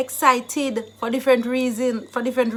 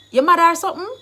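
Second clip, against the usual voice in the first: high, loud incredulous question; acted indignation, pitch shooting up; indignant challenge; 7 semitones higher, 9 dB louder, much faster than usual. Shown as a demonstration. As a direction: challenge them as if they're crazy. Acting out calling someone crazy.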